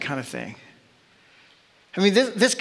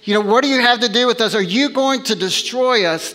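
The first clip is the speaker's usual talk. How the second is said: loud, fast, higher cry of the demon; a frightened demon shouting at Jesus; 5 semitones higher, 4 dB louder than usual. Acted. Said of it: "the demon cries out".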